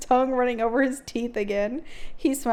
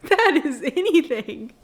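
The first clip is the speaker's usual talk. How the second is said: higher, louder, laughing through it; 5 semitones higher, 7 dB louder than usual. Real, not acted. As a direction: laugh while you say it. Laughing at loose pact terms.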